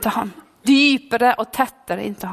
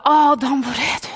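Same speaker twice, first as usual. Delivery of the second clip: calling fading. Acted. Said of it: "Gud fortsatte å rope".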